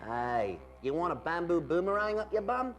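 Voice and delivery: threatening fake Aussie; mock-Australian menace; ridiculous Australian accent. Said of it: "your accent sounds completely ridiculous".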